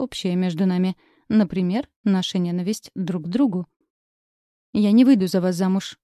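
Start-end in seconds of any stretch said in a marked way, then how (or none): 4.7-6.0 louder, challenging refusal; defiant. A narrator's reading. Girl refuses to marry James, defiant challenge. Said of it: "произнесла с вызовом".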